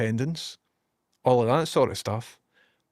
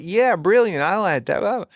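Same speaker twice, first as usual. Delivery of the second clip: higher, louder mock-enthusiastic reply; voice assistant's reply; 6 semitones higher, 4 dB louder than usual. Voice assistant approving his app feature, imitated enthusiasm. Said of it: "She's like".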